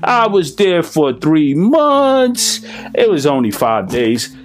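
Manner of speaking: mock earnest con man selling his story; bullshit artist voice. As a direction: ham up the reformed man to fool the family. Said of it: "as the bullshit artist, could have played myself".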